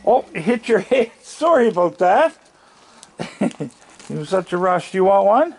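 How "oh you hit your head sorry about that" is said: high laughing apology; laughing sympathy. Real, not acted. Man laughing after the animal hit its head, higher. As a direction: chuckle a sorry, pitched up.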